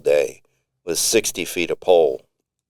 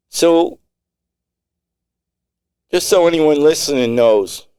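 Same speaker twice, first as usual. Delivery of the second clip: higher, louder warning to all; armed threat; 6 semitones higher, 4 dB louder than usual. Acted. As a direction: warn every listener you're armed. Tony's armed warning over radio.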